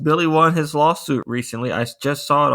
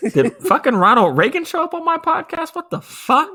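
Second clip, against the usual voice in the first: mock outrage. Real, not acted. Cohost reacting to a political joke, high mock-shocked yelp. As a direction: yelp in fake disbelief.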